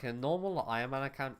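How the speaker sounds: "normal" lifted and leaned on; stress on NORMAL. Real, not acted. Player advising on carries, stressing "normal". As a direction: put the emphasis on "normal". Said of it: "emphasis on the word normal".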